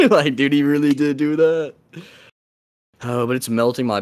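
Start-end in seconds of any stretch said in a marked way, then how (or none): 0.0-1.7 high-pitched, laughing it out. Real, not acted. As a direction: crack up while saying it. Laughing through the reaction.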